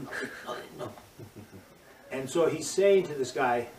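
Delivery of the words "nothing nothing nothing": very soft, low mock-winded voice; barely voiced, as if knocked flat by a fall; feeble retold reply. Student answers coach after a hard drop, feebly.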